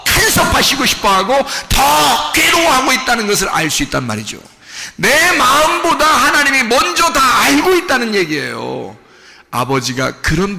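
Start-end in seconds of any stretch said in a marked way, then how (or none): 0.1-4.4 impassioned preaching. Real, not acted. Preacher listing joys and sorrows held in God, impassioned preaching. 5.0-9.0 impassioned preaching. Real, not acted. Preacher listing joys and sorrows held in God, impassioned preaching.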